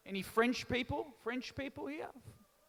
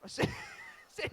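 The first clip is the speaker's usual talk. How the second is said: laughing; 4 dB quieter than usual. Real, not acted. Laughs at own joke.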